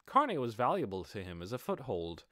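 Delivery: usual speaking voice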